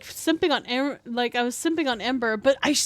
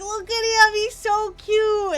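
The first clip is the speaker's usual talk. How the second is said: cooing; 9 semitones higher, 8 dB louder, slower than usual. Real, not acted. Cooing over a cute bug.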